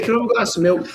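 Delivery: high mock child voice, Australian; a high-pitched Aussie kid asking Dad; little bogan kid. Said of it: "a little bogan".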